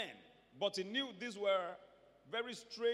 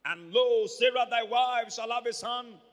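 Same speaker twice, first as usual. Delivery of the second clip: booming, stretched-out Bible reading; thundered scripture; 3 semitones higher, 11 dB louder than usual. Acted. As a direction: proclaim it at full preaching force. Preaching scripture at full force.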